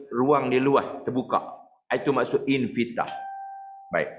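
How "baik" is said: ordinary delivery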